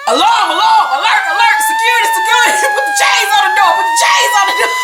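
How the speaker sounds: hammed-up panicked shriek; panicked shouting. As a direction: sound the alarm, shrieking and laughing. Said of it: "Kenya's like".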